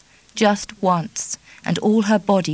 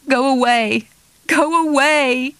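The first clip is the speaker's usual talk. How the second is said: higher and louder each time, pleading cry; rising cry; 7 semitones higher, 5 dB louder than usual. Acted. Kitty crying at Charlie to go, rising loud plea. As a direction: push him away with the words, building.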